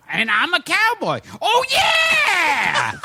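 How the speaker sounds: punchline exploding into a high, long yell; Freddie Rogers catchphrase. Retold or imitated. Imitating an old comic saving his punchline, high drawn-out shout. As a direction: deliver it, then wail the catchphrase high. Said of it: "he'd go".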